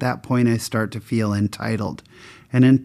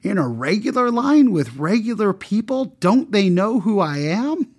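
haughty, much higher whine of outrage; entitled snob; 9 semitones higher than usual. Retold or imitated. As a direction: sneer at the regular people. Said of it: "I was so put out".

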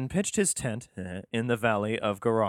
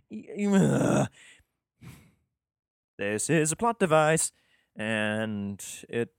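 ordinary talk

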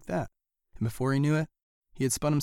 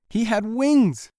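higher, louder, slow marvel; amazed; 9 semitones higher, 7 dB louder, much slower than usual. A narrator's reading. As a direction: savour the surprise. Delighted discovery of wings.

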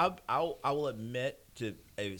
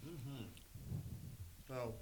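talking with mouth full; words through a mouthful of food; 3 semitones higher, 18 dB quieter than usual. Real, not acted. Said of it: "sorry, I got food in my mouth".

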